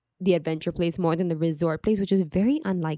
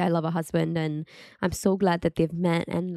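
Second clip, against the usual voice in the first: ordinary talk, nothing marked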